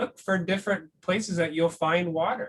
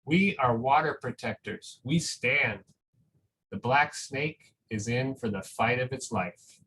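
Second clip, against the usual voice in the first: put-on reading to children; 3 semitones lower than usual. Reads a picture book aloud.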